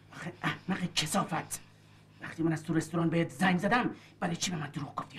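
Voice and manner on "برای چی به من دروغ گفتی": quiet menace. Acted. The man quietly presses the woman about her lie, before he shouts. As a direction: hold it down before the explosion.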